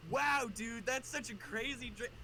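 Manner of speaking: put-on wowed reaction; feigned amazement. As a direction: play impressed to hide the prank. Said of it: "try and sound interested".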